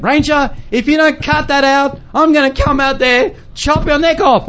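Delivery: high-pitched mock fury; retold angry threat. Missionary in his tent to his barking dog, mock angry threat. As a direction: threaten the dog in acted exasperation. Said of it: "And so I said".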